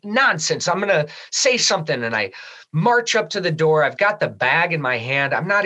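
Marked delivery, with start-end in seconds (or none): 0.0-2.0 indignant. Retold or imitated. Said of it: "I was like".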